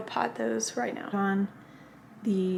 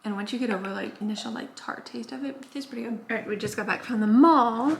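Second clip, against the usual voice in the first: normal talking voice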